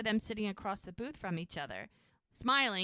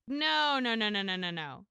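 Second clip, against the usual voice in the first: emphatic protest; 6 dB louder than usual. Retold or imitated. Jack won't let Mel regret the ring, emphatic protest. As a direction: cut in with a rush of reassuring refusals.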